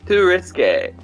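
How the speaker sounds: normal talking voice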